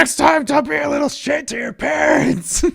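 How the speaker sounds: very high, loud scolding yell; angry Santa shout. Acted. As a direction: scream at the naughty kid.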